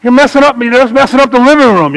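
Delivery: high pitched exasperated rebuke; retold scolding. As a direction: tell him off like a fed-up landlord. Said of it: "he said".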